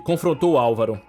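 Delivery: plain reading voice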